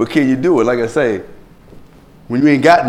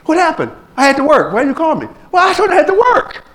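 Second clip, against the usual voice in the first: high-pitched mock quarrel, building faster; acted bickering; faster than usual. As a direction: act out a squabble, pitch climbing high. Acted quarrel over missed meeting.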